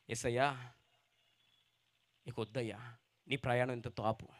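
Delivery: low, soft plea to stop; pleading messenger. Acted. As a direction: beg Jesus gently to turn back. Messenger tells Jesus to stop. (మెల్లగా వేడుకునే దూత గొంతు)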